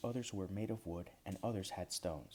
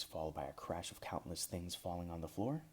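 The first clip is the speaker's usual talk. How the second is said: plain reading voice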